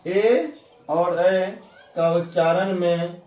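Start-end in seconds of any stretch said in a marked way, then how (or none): 0.1-1.5 "ए और ऐ" said very slowly and drawn out; drawn out vowels. Shown as a demonstration. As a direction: stretch each vowel for the class. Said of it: "ए, ऐ".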